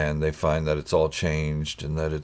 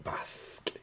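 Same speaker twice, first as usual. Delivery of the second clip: unvoiced whispered "Bosk"; whispering; 15 dB quieter than usual. Real, not acted. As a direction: breathe the name out softly. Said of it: "whispered name".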